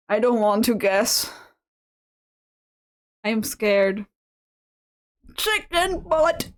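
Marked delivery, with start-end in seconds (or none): 5.4-6.5 higher, slow silly punchline. Acted. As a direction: drag out the dumb joke. Punchline joke.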